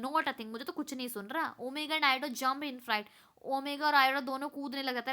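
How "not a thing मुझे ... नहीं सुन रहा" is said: normal talking voice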